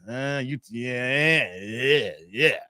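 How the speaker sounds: low, drawn-out mock reluctant yeahs; imitated unimpressed guy. Streamer imitates the guy who gets called bro, low mock-unimpressed. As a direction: act out a guy's low, doubtful response. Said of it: "He gonna look at you and saying".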